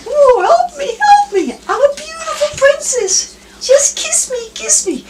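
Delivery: shrill little frog begging to be kissed; frog princess voice. Retold or imitated. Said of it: "And the frog goes".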